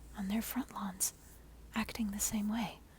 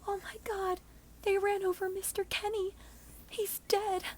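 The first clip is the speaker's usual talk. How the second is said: much higher, frantic mock scream; 11 semitones higher than usual. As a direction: neighbor shrieks at the crash. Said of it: "Shelley screams".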